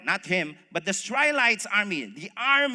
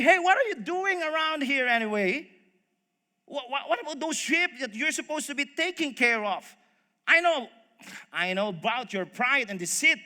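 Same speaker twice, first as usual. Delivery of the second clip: put-on cross older brother scolding, pitched up; angry; 4 semitones higher, 4 dB louder than usual. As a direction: tell off a younger brother.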